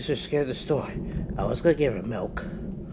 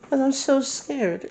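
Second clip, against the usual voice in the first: put-on scared baby; 13 semitones higher, 4 dB louder, slower than usual. Baby afraid of the storm.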